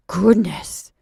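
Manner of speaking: loud surprised Goodness; exclamation. A narrator's reading. Mrs Gratz hearing of the money, a loud exclamation. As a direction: gasp it out, amazed at the sum. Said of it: "exclaimed Missus Gratz".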